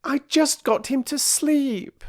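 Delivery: put-on high, slow girl's complaint; girl reproaching the woman who woke the baby; reproachful. A narrator's reading. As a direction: complain she undid your work. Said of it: "said Phyllis reproachfully".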